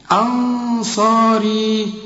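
أنصاري with the long a held for four counts; four-count madd. Shown as a demonstration. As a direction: stretch the long vowel in أنصاري. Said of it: "هنا تمد أربع حركات".

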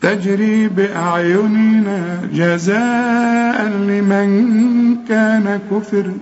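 slow chanted tajweed recitation; Quran recitation. Acted. Teacher leading the class in reciting the verses, slow chanted tajweed. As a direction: chant each verse slowly, holding the long vowels.

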